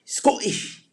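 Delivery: high, punchy mock-Scottish burst; bad Scottish accent. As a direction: badly fake a Scottish accent on one word. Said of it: "the worst Scottish impersonation I could ever do".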